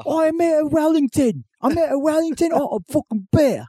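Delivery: high, sneering Cockney rant; mock Cockney accent. Parody British contestant cursing Thatcher, mock Cockney accent. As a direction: spit insults like a drunk Brit. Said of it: "Cockney accent".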